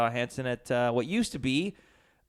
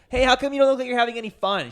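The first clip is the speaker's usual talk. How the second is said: high-pitched mock girl voice, louder; pushy girl at the concert; 11 semitones higher, 9 dB louder, faster than usual. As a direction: play a nosy girl needling a friend. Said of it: "this one girl came up to me and she's like".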